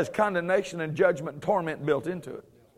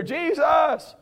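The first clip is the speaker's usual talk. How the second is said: mild fearful cry; 7 semitones higher than usual. Retold or imitated. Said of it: "cried out in fear".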